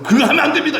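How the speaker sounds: high-pitched mock protest from a priest; alarmed priest's protest. Priests stop the king burning incense, alarmed protest. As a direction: squeal out the priest's objection, half laughing.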